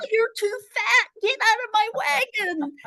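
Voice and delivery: put-on high-pitched bratty kid yelling; little boy yelling at the woman who broke his wagon; screaming boy. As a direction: shout it down like a spoiled child. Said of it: "he starts to scream".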